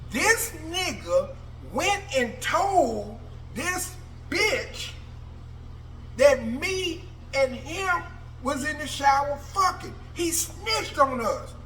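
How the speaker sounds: rally the room against him; furious call-out. Retold or imitated. Inmate accusing the snitch to the dorm, high and furious.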